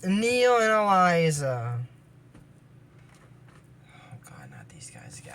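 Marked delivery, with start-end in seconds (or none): 3.9-5.3 hushed groaning whisper. Real, not acted. Whispering to viewers about the bad kids. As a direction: whisper it in dread. Dreading the villains' return.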